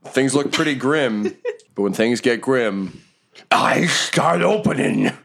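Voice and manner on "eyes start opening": high, slow, drawn-out creepy delivery; ominous mock reveal. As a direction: stretch out the eerie reveal, hammed up. Game master hams up monster reveal.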